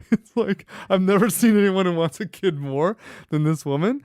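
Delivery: tickled, breaking into laughs as you talk; real laughing. Real, not acted. Telling host about baby-crazy sister-in-law, laughing.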